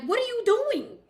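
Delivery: high, loud challenge; confronting call. Retold or imitated. Imagined stepping between the fighters, a loud high challenge. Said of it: "I would have stood between them like".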